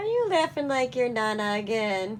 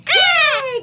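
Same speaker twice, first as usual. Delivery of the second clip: high, loud yay of praise; excited cheer; 13 semitones higher, 13 dB louder than usual. Real, not acted. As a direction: cheer the bird on big. Praising parrot's trick.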